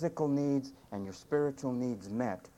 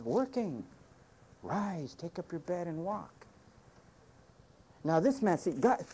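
normal talking voice

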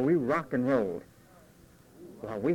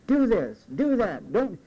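1 mock parent yelling; 5 semitones higher, 4 dB louder, slower than usual. Parent screaming at children.

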